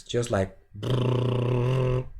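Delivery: slow rolling lip buzz brrrr; lip trill. Shown as a demonstration. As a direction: let the lips flutter on a long brrrr. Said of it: "any specific sounds".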